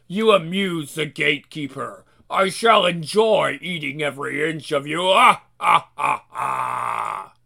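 low menacing monster voice; menacing demon. Acted. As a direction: gloat like a hungry beast. Gatekeeper demon threatens.

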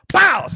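sharp high mock punch noise; punch sound. Narrator mimicking Jesus punching Percy, high pop. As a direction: pop out a fake punch effect.

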